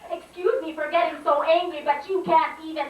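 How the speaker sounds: high-pitched, driving poetry delivery; indignant slam poem. Acted. Poet protests her mispronounced name, indignant spoken-word declaiming. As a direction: declaim the grievance phrase by phrase.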